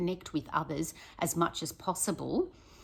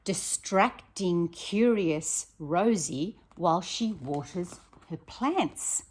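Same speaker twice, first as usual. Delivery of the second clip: slow, louder read-aloud; storybook reading; 5 dB louder, slower than usual. A narrator's reading. Teacher reading Rosie's page slowly to her class. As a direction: draw out each word, like a picture book.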